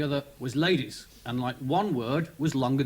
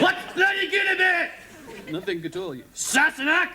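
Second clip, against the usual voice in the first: grumpy Scotsman; 11 semitones higher than usual. Retold or imitated. Scottish prisoner snaps back at the morning greeting. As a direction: snarl it in a Scottish accent. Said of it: "this Scotsman".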